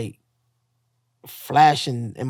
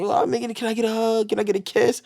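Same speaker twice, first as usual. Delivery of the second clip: higher, even-toned begging voice; imitated pleading patient; 6 semitones higher than usual. Fellow patient asking him for a hug, mock pleading. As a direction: mimic a needy stranger, pitched up.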